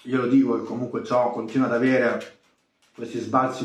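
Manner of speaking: talking with hand over mouth; muffled speech mid-chew; mouth full, chewing. Real, not acted. Seen 1: Bite taken at -4; hand covers mouth while chewing until 3.5.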